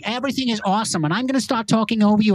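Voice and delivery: put-on, gushing American accent. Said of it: "if we switch over to America".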